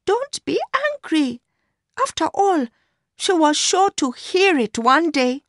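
put-on very high young woman's voice; Mercy, appeasing him. A narrator's reading. Mistress asking her lover not to be angry, very high young woman's voice.

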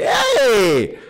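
high squealing hype greeting; mock excited shoutout. Streamer mock-greeting the chat in a squealing hype voice. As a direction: squeal a hyped thank-you to a streamer. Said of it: "so I can say".

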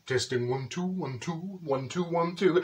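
soft, low mic-test murmur; mock sound-check, quiet and measured; microphone check. Testing the bingo microphone.

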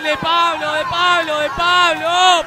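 screaming goal call; goal commentary shout. Real, not acted. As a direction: belt out the goal, stretching it. Commentator calls Pablo's goal.

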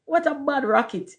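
high-pitched exclamation; cried out. A narrator's reading. Workman finding the leftover rocket, exclaiming. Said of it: "he cried".

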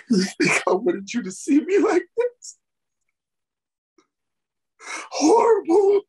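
crying. Acted. Dying man ashamed before his friends, crying. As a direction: cry out the shame of being seen like this.

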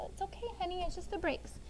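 reassuring. Retold or imitated. Mother soothing her toddler in the back seat, softly. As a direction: comfort the little one gently. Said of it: "Mommy, what wrong".

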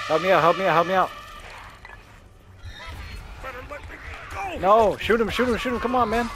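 urgent yelling. Real, not acted. Frantic teammate yelling mid-fight.

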